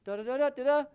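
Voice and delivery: ordinary talk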